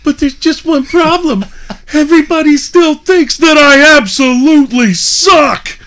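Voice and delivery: high, loud mimicry of a whiner; mocking Billy at prayer. Wrestler ridiculing Billy's prayer, high loud mock voice. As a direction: build the fake prayer to a shout. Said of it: "and it sounded like this".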